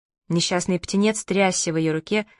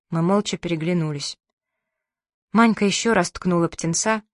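plain reading voice

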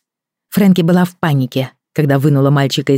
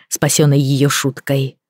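usual speaking voice

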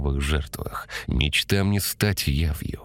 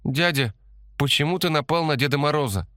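put-on high, fast child's voice, puzzled; a five-year-old asking in wonder; surprised little girl; 11 semitones higher than usual. A narrator's reading. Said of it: "удивилась пятилетняя девочка". (удивлённый детский голос)

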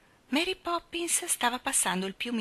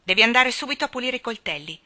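fast, louder command; ordering; 4 semitones higher, 7 dB louder, faster than usual. Acted. Jane ordering the gardener to clean knives, commanding. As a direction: snap out the order. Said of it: "ella ordinò".

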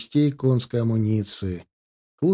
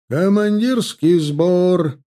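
slow, louder, higher drawn-out call; bugle-call shout; 5 semitones higher, 5 dB louder, much slower than usual. A narrator's reading. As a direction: ring it out like a bugler. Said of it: "трубил штаб-трубач".

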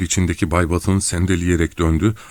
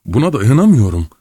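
much louder and higher outburst; hoarse shout; 6 semitones higher, 6 dB louder than usual. A narrator's reading. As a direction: shout it, at the end of his rope. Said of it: "diye bağırdı boğuk bir sesle".

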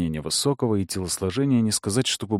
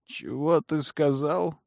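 hoarse with fury; 7 semitones higher, slower than usual. Acted. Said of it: "Севшим от ярости голосом".

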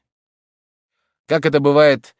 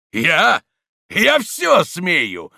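loud, slow, insolent answer; brazen defiant retort; 8 semitones higher, 4 dB louder, much slower than usual. A narrator's reading. Stonecutter answering the priest, brazen defiance. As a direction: boast that he dares anything. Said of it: "отвечал камнелом".

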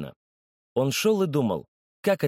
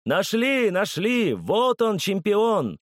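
crowd cheering; 9 semitones higher, much slower than usual. A narrator's reading. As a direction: hail the champion from the crowd. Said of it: "и кричали".

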